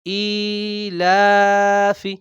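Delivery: ইলাফি with ই and লা held long, ফি short; very slow, drawn-out ই and লা in ইলাফি; correct elongation. Shown as a demonstration. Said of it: "শুদ্ধ ইলাফি". (ইলাফি-র ই আর লা-তে লম্বা টান)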